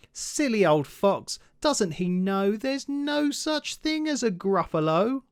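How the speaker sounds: high, slow and self-satisfied; smug mouse. Acted. Mouse laughing off the fox, high and slow. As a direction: savour the trick.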